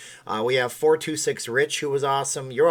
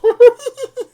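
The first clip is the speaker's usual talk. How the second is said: very high, loud put-on giggle; piercing hammed-up girlish laugh; Japanese girl giggle; 19 dB louder than usual. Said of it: "like, a Japanese girl".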